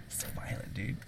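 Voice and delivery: whispered. Real, not acted. Jokes about the violence.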